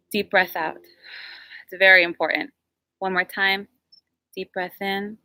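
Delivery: calm breathing instructions; guided breathing. Acted. Leads a breathing exercise.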